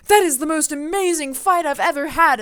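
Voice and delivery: high, loud delighted exclaim; delighted hero. Retold or imitated. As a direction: gush about the fight. Robin delighted after fight.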